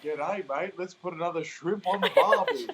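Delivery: lightly hammed-up Aussie movie quote; movie character's Aussie line. Quoting a Dumb and Dumber scene, in lightly mocking Aussie voice. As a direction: raise the pitch a little for a mock Aussie greeting. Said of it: "there's a scene where he's like".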